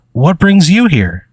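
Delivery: high, drawn-out curious question; Penny. Acted. Pen girl asks the newcomer a question, high and slow. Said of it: "Penny goes".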